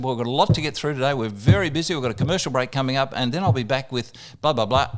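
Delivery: fast, brisk wrap-up; hurried radio host. Retold or imitated. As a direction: rush the guest off air. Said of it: "Tony said".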